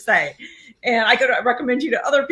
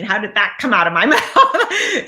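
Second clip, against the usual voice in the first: amused disbelief. Retold or imitated. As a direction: question dissolving into laughter. Amused at own grand promise.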